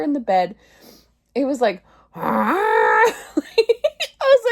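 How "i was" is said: high, loud laughing burst; laughing out loud at her own snoring. Real, not acted. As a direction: crack up mid-sentence. Bursts out laughing recalling recording.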